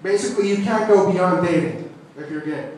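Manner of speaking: normal talking voice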